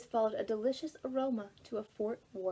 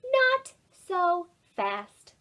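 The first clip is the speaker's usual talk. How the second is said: very slow, higher, louder stop; slow firm warning; 8 semitones higher, 7 dB louder, much slower than usual. A narrator's reading. Ruby to the boys, a very slow, firm warning. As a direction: hold them back word by word.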